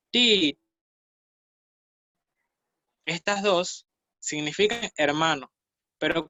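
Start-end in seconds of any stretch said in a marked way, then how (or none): 0.1-0.6 "ti" pitched high, then falling; pronunciation model. Shown as a demonstration. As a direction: model "ti" raised and falling for students. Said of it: "Tenemos la segunda que es".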